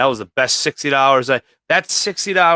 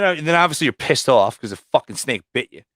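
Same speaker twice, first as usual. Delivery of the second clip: usual speaking voice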